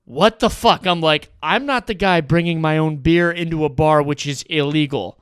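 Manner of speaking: loud, outraged comeback; angry retort. Retold or imitated. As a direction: shout back in disbelief. Arguing with the table.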